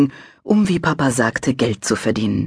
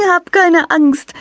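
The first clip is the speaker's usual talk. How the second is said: laughing reassurance; 6 dB louder, slower than usual. A narrator's reading. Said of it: "lachte das Wesen".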